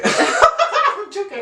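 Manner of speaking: drawn-out laughing high voice; giggling disclaimer. Real, not acted. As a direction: laugh it off slowly. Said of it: "Are you though?".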